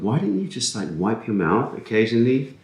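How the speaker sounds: high-pitched mock scolding of oneself; squeaky, hammed-up reproach; mock exasperation. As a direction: leap up high in exasperated disbelief. Voices own reaction to edits.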